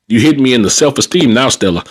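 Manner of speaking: ordinary talk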